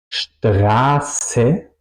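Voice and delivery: long A and long sharp S in "Straße"; tongue touches teeth on the held S of "Straße"; ese picante. Shown as a demonstration. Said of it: "mi lengua toca al final". ("Straße" con ese larga tocando los dientes)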